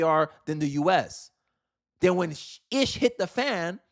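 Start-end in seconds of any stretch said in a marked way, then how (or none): none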